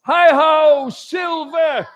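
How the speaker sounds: high ringing heroic shout; a mighty cowboy yell to his horse; mighty cry. Acted. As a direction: bellow it to the horizon like a cowboy hero. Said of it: "with a mighty cry of".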